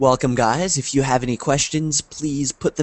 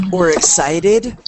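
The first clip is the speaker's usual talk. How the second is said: excited tone; 5 semitones higher, slower than usual. Shown as a demonstration. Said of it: "The sound of our voice".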